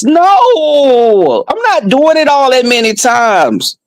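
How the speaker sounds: high-pitched, exasperated refusal; indignant protest. Acted. Comedian refusing a lover's demands, indignant. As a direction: wail the refusal in comic outrage.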